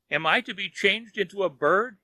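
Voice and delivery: high groaning plea of despair; a frightened man groaning in his bed; groaned. A narrator's reading. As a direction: moan it out in dread. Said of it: "he groaned".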